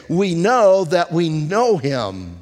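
"know" stretched and stressed both times; slow scripture read leaning on "know"; slow emphatic quote. Shown as a demonstration. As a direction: press every word, slowly. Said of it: "Now listen to what he's saying".

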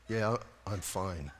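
low, soft, slow dazed reply; stunned. Retold or imitated. Stunned patient answering the nurse, quiet and dazed. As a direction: play deer in the headlights, very small voice. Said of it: "deer in the headlights".

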